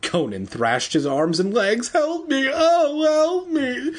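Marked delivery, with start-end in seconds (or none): 1.9-3.9 hammed-up shriek, extremely high falsetto. A narrator's reading. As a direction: squeal for help like a panicked child. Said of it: "he shrieked".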